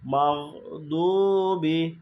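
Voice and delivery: "মাগদুবি" slow, দু drawn out; এক আলিফ টান. Shown as a demonstration. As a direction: stop the g, then lengthen the u in "মাগদুবি". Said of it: "এই জন্য এক আলিফ টান হবে". ("মাগদুবি"-র দু টেনে)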